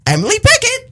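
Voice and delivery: high, bright radio drop; jingle callout. Acted. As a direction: sing it out like a station ID. Silly nickname jingle callout.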